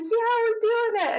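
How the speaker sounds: emotional cheer. Retold or imitated. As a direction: squeal it back, overwhelmed. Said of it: "And I'm getting emotional".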